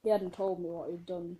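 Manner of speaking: ordinary talk, nothing marked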